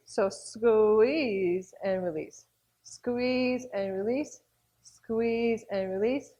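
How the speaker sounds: slow, drawn-out exercise cue; slow instructor count; slow squeeze cue. Shown as a demonstration. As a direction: stretch each word out to set a slow tempo. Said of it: "A little bit faster too".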